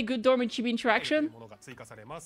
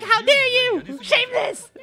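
high, louder indignant squeal; mock outrage; 12 semitones higher, 4 dB louder, slower than usual. Voicing the mad character in the background, high mock-indignant cry. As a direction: play-act a scandalized scolding. Acting out a character's angry scolding.

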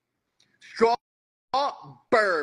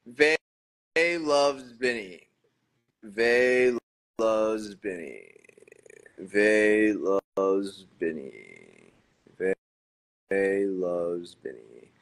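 slow name searching; 9 semitones lower, much slower than usual. Real, not acted. Repeating name, slower and lower.

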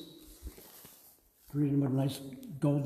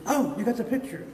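put-on higher, lively old dad voice; a delighted father, a bit higher; pleased dad; 8 semitones higher, 4 dB louder than usual.

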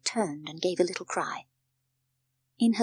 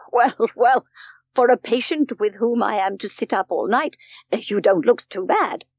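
loud, hearty teasing; amused old doctor, louder and emphatic; jovial doctor; 3 semitones higher, 8 dB louder than usual. A narrator's reading. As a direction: tease your friend in a big, booming voice. Doctor laughs at his supposed patient.

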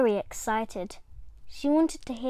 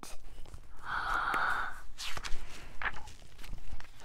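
soft gasp; 3 dB quieter than usual. A narrator's reading. Mirror on her bed.

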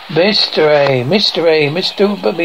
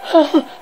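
high short gasp; 10 semitones higher than usual. Acted. Reader voices the captioned reaction, high gasp.

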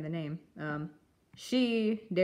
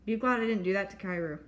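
ordinary delivery

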